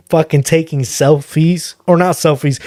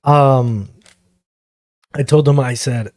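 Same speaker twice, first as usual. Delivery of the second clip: ordinary talk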